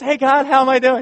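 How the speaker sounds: high, eager question; eager asking. Retold or imitated. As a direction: ask hopefully. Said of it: "And you're just".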